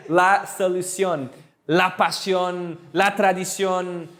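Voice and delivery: solución, pasión, tradición, each drawn out with stress on the final ÓN; deliberate teacher's rhythm on solución, pasión, tradición; slow model pronunciation. Shown as a demonstration. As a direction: stretch solución, pasión, tradición slowly for learners.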